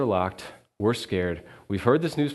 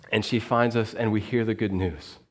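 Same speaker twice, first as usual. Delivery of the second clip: normal talking voice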